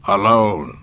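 dark lord's weighty declaration; ominous Sith lord. A narrator's reading. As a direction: pronounce it with grim finality. Vader's ominous last word.

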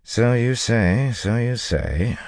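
drawn-out, doubtful muttering; muttered. A narrator's reading. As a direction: mutter it slowly, unconvinced. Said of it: "Matt muttered".

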